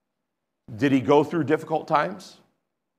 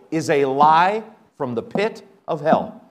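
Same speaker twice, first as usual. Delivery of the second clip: usual speaking voice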